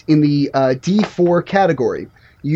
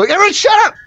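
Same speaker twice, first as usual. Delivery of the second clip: high-pitched indignant yell; mock yell; 12 semitones higher, 3 dB louder than usual. Shouting back at the car radio, mock yell.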